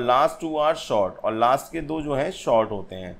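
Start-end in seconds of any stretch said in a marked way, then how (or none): 0.0-1.2 slow dictation. Shown as a demonstration. Reading out a definition slowly.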